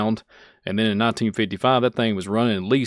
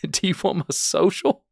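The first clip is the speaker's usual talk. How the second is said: mock naive worshipper; 8 semitones higher than usual. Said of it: "If the rock starts talking".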